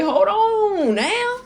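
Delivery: a hammed-up God checking on your chores; God's voice. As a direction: stretch it out like a high, teasing God scolding you. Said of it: "God is like".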